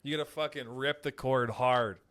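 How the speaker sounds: ordinary delivery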